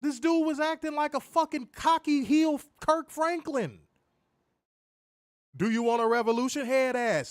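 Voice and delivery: high, heated Chicago accent. Real, not acted. Podcaster ranting about a cocky wrestler, Chicago accent. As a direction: let the Chicago accent out as you rant. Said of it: "This shit got my Chicago accent coming out".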